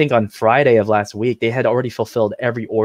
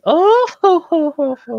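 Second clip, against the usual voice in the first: high haughty "oh ho ho ho"; smug laugh; 5 dB louder than usual. Pretend. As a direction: laugh like a smug anime noble. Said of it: "outsmug Sylvia".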